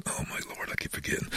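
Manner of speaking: hushed, whispery aside to himself; quiet self-reproach, acted as inner thought; private thought. Voices his private embarrassed thought.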